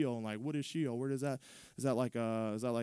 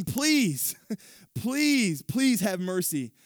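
pleading, high and louder, voice cracking; desperate begging; 5 semitones higher, 6 dB louder, much slower than usual. Acted. Rich man in torment begging Abraham, desperate high pleading. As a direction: beg for mercy from the flames. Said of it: "He's begging".

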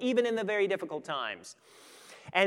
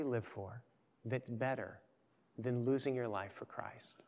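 softly whispered question; 6 semitones lower, 9 dB quieter than usual. Acted. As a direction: lean in and whisper the challenge. Preacher's hushed closing challenge.

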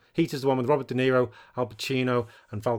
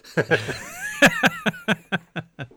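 high burst of real laughter; big laugh; 10 semitones higher than usual. Real, not acted. Laughing at a joke.

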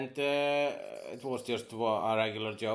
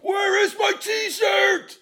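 mock shrieking yell, much higher and louder, slow; insanely angry; 12 dB louder, much slower than usual. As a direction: scream it like a raging customer. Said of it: "insanely angry".

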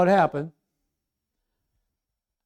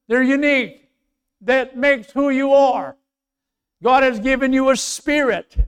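loud, high pulpit delivery; impassioned, raised sermon voice; full-force preaching; 8 semitones higher, 10 dB louder than usual. Acted. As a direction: preach it out to the whole church.

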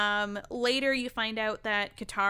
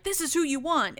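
pitched-up announcing imitation; mock captor; 7 semitones higher than usual. Captors handing their catch to Boom Man, announcing. Captors point out targets, acted.

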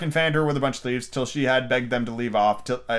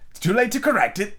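high, firm pronouncement; overruling queen; 10 semitones higher, 3 dB louder than usual. A narrator's reading. Red Queen refusing Alice's correction, imperious. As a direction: rule it out as a high-voiced queen.

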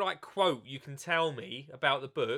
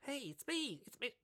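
much higher voice, put-on; mock Matt Biggs, pitched way up; Matt Biggs impression; 10 semitones higher, 10 dB quieter than usual. To his viewers, mimicking the gardener Matt Biggs, high put-on voice. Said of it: "shall I do the last bit in his bit?".

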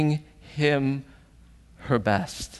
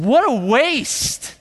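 loud, high, drawn-out indignation; mad; 10 semitones higher, 5 dB louder, much slower than usual. Acted. Disciples seeing the ointment poured out, mad. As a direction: stretch it out in angry protest. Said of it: "No, they were mad".

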